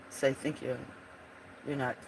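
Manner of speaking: ordinary delivery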